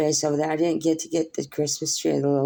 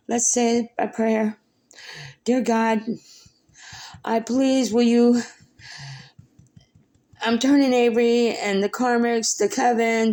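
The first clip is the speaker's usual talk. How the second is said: higher, pleading prayer; tearful prayer; 6 semitones higher than usual. Real, not acted. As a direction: pray while still choked up. Tearful prayer aloud to God.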